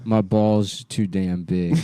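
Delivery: slow, drawn-out put-on voice; mock dumb drawl. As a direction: stretch it out like a dopey character. Mock movie line, drawn out.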